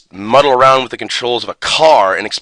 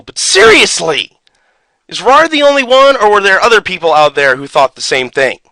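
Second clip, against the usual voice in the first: higher and louder, outraged plea; exasperated rant; 6 semitones higher, 5 dB louder than usual. Real, not acted. Asking the audience if anyone else was fooled, exasperated rant. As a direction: throw up your hands at everyone. Ranting at viewers.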